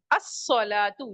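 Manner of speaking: अस्सलातु slowly as as-salatu, no lam; slow demo word. Shown as a demonstration. Teacher answers her own question, saying अस्सलातु slowly. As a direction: stretch अस्सलातु out with the s doubled. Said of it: "तो कैसे पढ़ेंगे इसको?".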